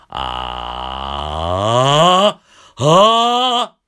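creaky ah sliding up to a loud high note; fry rising into a loud high tone; high note demo. Shown as a demonstration. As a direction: glide up and open into a full high ah. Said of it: "これ高い音になると".

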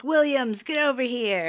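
coach calling out. Retold or imitated. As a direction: bark out the surname like a gym coach. Coach calling a player over.

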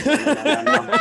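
high, loud laughing no no no; emphatic refusal. Real, not acted. Host asks if he could do Australian, laughing loud refusal. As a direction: shoot the idea down fast, laughing.

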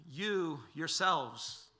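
"You yourselves" drawn out, each word given weight; slow right down on "You yourselves"; emphatic, slow. Shown as a demonstration. Preacher spelling out Jesus' emphasis for his hearers, slow and deliberate. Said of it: "He's em-, emphasizing who they are".

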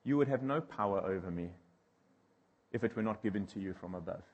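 Jesus calmly answering Pilate, soft and low. Retold or imitated. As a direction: answer with unshakable quiet calm. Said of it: "Jesus just calmly says".

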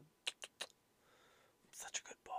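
soft slow whisper to yourself. Real, not acted. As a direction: breathe it out, barely voiced.

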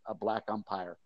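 ordinary talk

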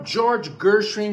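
"George Gershwin" slowly with English r; English pronunciation. Shown as a demonstration. Teacher shows viewers a correct name, English pronunciation. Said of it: "mas sem exagero".

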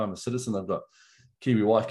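normal talking voice

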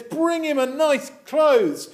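high, loud, celebratory call; shouting to the household. Acted. Father to the people in the house, joyful shout. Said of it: "he shouts to the people in the house".

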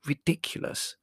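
breathy, disgusted hiss; whispery scorn. A narrator's reading. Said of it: "hissed Prue".

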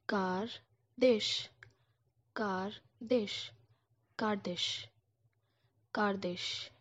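"Kardish" said as kar-desh, slow; careful, repeated "Kardish"; repeated slowly. Shown as a demonstration. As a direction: learner practice. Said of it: "sounds like this".

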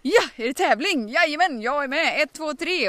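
loud, high, eager counting; fired-up yes. Retold or imitated. Wife suddenly fired up for the jump, loud and eager. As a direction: burst into it, fired up.

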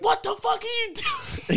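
very high, slow disbelieving shout; shocked outcry. Retold or imitated. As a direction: squeal it in horrified disbelief. Friends react to fish swallowing.

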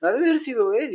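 high, whiny denial from a caught schoolboy; squeaky schoolboy swearing it wasn't him; scared kid denying. Retold or imitated. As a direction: plead innocence like a cornered kid. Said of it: "como típico chavo meco".

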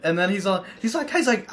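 ordinary talk

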